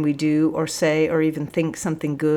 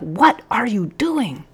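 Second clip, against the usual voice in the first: higher, slow, incredulous question; alarmed cry; 4 semitones higher, slower than usual. A narrator's reading. Sergeant leaping up to question the cadet, alarmed cry. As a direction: cry it out, drawing the words out. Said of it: "cried the sergeant, leaping up".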